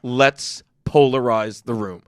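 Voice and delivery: slow, low, measured quote; deliberate. Retold or imitated. Comic recalls choosing to polarize the crowd, slow and deliberate. Quoting his deliberate provoking self.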